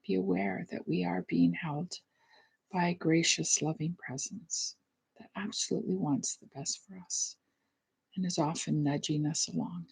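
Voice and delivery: soft, hushed reflective prayer; gentle meditation voice, trailing softly; guided meditation. Real, not acted. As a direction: speak quietly into the stillness, pausing between phrases.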